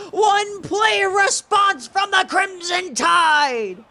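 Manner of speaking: very high, loud, slow shout; screaming touchdown call. Real, not acted. Long touchdown pass.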